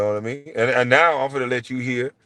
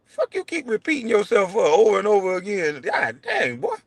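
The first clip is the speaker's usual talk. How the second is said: high-pitched retold exasperation; exasperated complaint; 8 semitones higher than usual. Host fed up with a guest repeating himself, high exasperated complaint. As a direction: pitch climbing to a cry at the end, fed up. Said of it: "I'm like".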